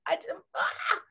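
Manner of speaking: high, frustrated outburst on ah; exasperated groan. Retold or imitated. Young mom venting over sick kids, exasperated. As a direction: let out the frustration of plans ruined.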